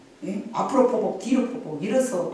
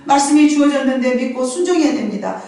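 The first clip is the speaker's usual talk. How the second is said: loud, raised preaching voice; full-force preaching; 5 semitones higher, 5 dB louder than usual. Acted. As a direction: proclaim it to the congregation. Preaching to the congregation. (힘찬 설교 톤)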